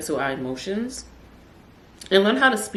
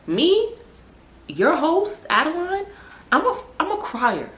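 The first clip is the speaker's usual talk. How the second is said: much higher, playfully incredulous; mock disbelief; 12 semitones higher than usual. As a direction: say it as if scandalised at the very idea. Acts shocked at being no crier.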